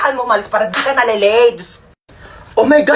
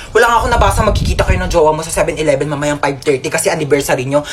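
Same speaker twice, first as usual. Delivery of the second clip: ordinary talk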